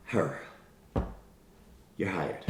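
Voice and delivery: low, quiet and offhand. Acted. Boss picking a student at a glance, offhand. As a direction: toss it out softly, low in pitch.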